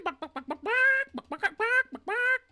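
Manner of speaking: high loud yappy dog barks; dog barking. Pretend. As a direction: bark like a small excited dog. Barking at a chicken.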